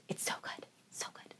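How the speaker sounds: breathy hushed whisper, almost silent; whispering so good under her breath; whispered gush. Real, not acted. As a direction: drop to a secret whisper of delight. Gushing whisper about the book.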